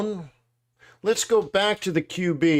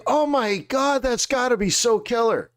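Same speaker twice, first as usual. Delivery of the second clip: high, gushing "oh my God"; mock-excited fanboy gush; 7 semitones higher than usual. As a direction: gush like you can't believe your luck. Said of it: "And I'm like".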